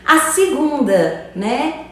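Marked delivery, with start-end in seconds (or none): none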